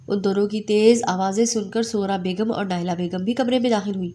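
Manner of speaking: plain reading voice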